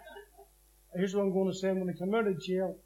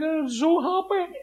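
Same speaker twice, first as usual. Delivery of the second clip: tearful joy; 7 semitones higher, 4 dB louder than usual. Retold or imitated. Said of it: "he seen me crying".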